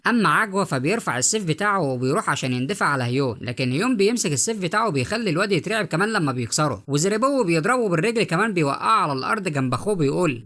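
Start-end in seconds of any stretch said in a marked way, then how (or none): none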